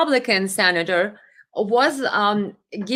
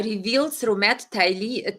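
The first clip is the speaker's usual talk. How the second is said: ordinary talk, nothing marked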